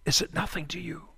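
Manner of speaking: soft, dropped repeat of the cry; hushed echo. Acted. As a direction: let the question fall away into a near hush. Widow's hushed repeated question.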